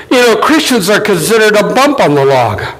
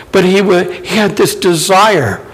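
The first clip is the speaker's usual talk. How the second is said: ordinary talk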